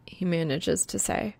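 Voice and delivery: usual speaking voice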